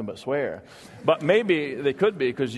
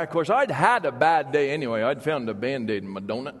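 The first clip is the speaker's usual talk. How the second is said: put-on storyteller Vermont accent, unhurried and dry; rural Vermont logger accent. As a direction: tell it like an old Vermont logger spinning a yarn. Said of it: "you've got the accent down".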